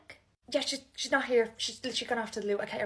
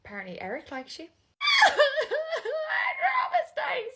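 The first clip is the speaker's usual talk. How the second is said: much higher laughing cry; laughing shout; 6 dB louder, slower than usual. Acted. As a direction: laugh it out loud. Friends' banter, laughing shout.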